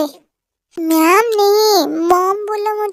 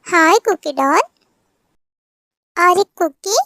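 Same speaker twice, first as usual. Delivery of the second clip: ordinary talk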